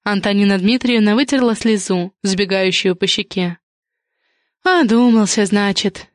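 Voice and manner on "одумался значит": plain reading voice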